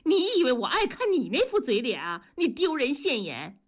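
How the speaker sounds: high-pitched venomous retort; hateful. A narrator's reading. Wife answers departing husband, hatefully. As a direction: hurl the insult back with loathing. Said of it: "文丽就恨声地说".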